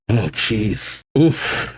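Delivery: fighting back real tears; choked up. Real, not acted. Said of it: "I didn't think I'd get emotional".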